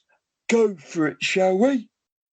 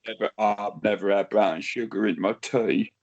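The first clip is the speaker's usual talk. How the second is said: deep comic voice; 12 semitones lower than usual. Pretend.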